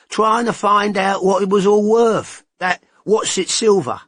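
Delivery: a gossiping informant, keen to tell; Todd's voice. A narrator's reading.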